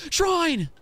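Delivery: very high, loud cry; a loud high yelp of joy; excited shout. Real, not acted. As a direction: call out the find with delight. Spotting a shrine.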